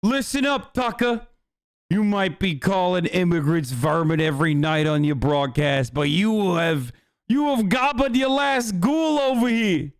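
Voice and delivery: hammed-up Italian American rant, rising high at the end; Italian American accent. Streamer warning Tucker in a put-on, indignant Italian American accent. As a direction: threaten like a mock Italian American wise guy. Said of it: "I'm doing an Italian American accent".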